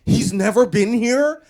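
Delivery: high, loud astonished question; can't believe it; shocked disbelief. Retold or imitated. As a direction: staffer stunned he never came. Staff amazed.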